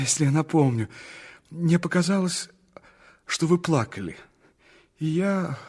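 usual speaking voice

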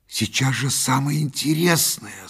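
high, annoyed objection; vexed protest. A narrator's reading. Son objecting to his mother at the show, vexed. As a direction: pitch it up in frustration. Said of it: "сказал я с досадой".

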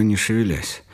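plain reading voice

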